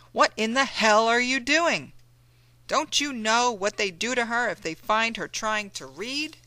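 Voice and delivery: Mammy warning John of the whip, furious; furious scolding. Acted. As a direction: scold him with fear underneath. Mammy scolds John about reading.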